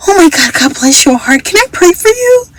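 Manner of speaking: high, lightly voiced church lady offering to pray; a hint of a sweet praying grandma; tender old lady. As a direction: coo it like a kindly older woman. Voicing the lady's blessing.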